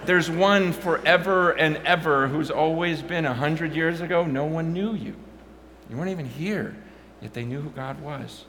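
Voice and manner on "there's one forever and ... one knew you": loud, high, impassioned preaching; fired-up sermon voice; preaching at full force. Acted. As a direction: preach it hard to the crowd.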